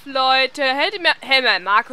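ordinary talk, nothing marked

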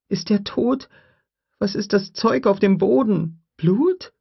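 put-on a curious little girl asking; young girl voice. Lex asks Grant about the raptor, high child voice.

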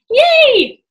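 high, loud yay; cheering. Acted. Kids cheer at success.

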